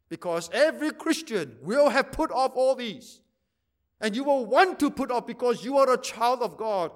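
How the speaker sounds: ordinary talk, nothing marked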